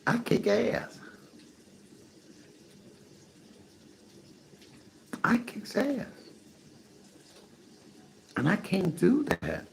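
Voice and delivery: a hammed-up high voice with the word ass kept in; mock self-quote. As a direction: pitch it way up to retell what she said while out of breath. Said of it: "I said".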